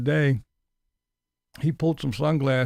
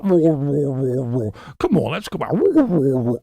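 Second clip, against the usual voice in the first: mocking dog owner; 3 semitones higher, 3 dB louder than usual. Mocking a vlogger talking to his dog. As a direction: ham up a guy fussing over his rolling dog. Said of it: "the dog rolling around going".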